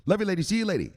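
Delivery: ordinary delivery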